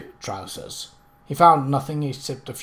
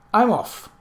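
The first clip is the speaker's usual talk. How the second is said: brisk; 8 semitones higher, 5 dB louder than usual. A narrator's reading. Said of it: "Miss Ardley said briskly".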